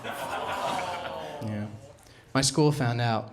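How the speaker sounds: usual speaking voice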